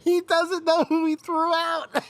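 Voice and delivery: laughing at the clueless umpire; incredulous laughing. Real, not acted. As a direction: squeak it out in disbelief.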